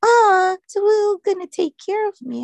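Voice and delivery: high-pitched imitation of a dismayed patient; mock dismay. Patient hearing her nurse will be away, mock dismay. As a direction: wail it in playful imitation, loud at first.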